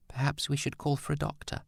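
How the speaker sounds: anxious whisper; whispered suggestion. A narrator's reading. Edna whispering to Kate that they should call a doctor. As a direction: lean in and whisper it urgently. Worried aside amid the crisis.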